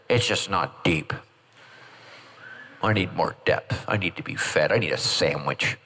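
low mock grumble; grumbling churchgoer. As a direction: drop the voice deep and whine. Same churchgoer now complaining.

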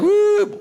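high, loud whoop; mock worship whoop. Pastor mimicking an excited worshipper, high loud whoop. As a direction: let out a high woo. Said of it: "You're like".

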